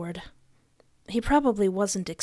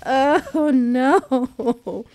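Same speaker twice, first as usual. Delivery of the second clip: high, loud tearful wail; crying a little; 4 semitones higher, 5 dB louder, much slower than usual. Real, not acted. Player crying after hurting the baby, high and loud. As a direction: wail through real tears and laughter. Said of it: "I'm actually crying a little bit".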